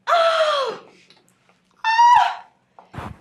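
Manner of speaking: very high loud shriek of delight. Acted. Customer shrieking in delight at the dresses in the shop. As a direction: gasp out loud at the beautiful dresses.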